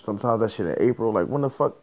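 normal talking voice

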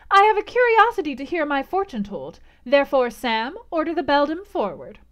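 high, loud imperious reply; haughty. A narrator's reading. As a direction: overrule mamma with cool pride. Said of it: "the haughty voice of Blanche".

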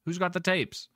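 high-pitched mock shout. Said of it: "you hear another voice shout out".

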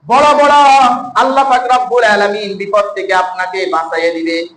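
ordinary delivery

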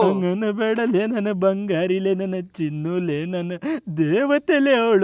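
very slow, low, lovelorn whimper; tearful adoration. Acted. Husband defending his runaway darling, tearful adoration. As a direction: drag out each pet name mournfully.

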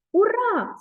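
high, bright cry of delight; joyful cheer. Acted. Spider rejoices at being invited home, excited cry. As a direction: cheer like a happy little spider. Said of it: "зрадів павучок".

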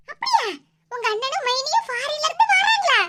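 high, excited exclamation too loud for secrecy. Acted. Wife blurts the news loudly over the phone, excited. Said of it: "உன்கிட்ட சத்தம் போடாம பேசுன்னு சொன்னோம்ல".

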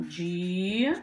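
"Di-" held long as a lone syllable; syllable division. Shown as a demonstration. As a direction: dictate "Di-" drawn out, then stop. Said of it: "vamos à divisão".

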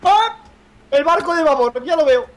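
high, loud cry of discovery; loud, thrilled shout; excited sighting. Real, not acted. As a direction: call out as you spot it. Spots the landmark at last.

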